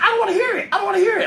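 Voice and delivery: high-pitched mock mom refusing; imitating his mom. Retelling his mom shutting down weed talk, high mock voice. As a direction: squeak it out like an exasperated mother. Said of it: "She's like".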